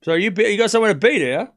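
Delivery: fast, loud, high-pitched needling; pushy mock interrogation; mocking challenge. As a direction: badger a complainer with sarcastic questioning. Mockingly challenging the impatient chatter.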